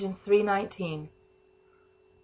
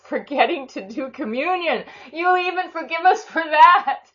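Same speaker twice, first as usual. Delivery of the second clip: delighted prayer; 8 semitones higher, 8 dB louder than usual. Real, not acted. Laughing wonder in prayer.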